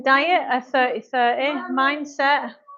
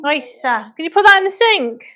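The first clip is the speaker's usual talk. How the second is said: high, loud call across the room; 5 semitones higher, 7 dB louder than usual. Real, not acted. Calling to daughter Lisa across room.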